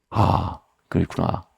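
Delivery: low, slow, understated letdown; mildly deflated realization. Retold or imitated. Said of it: "이렇게 들리는 거예요". (낮고 느리게 씁쓸한 깨달음)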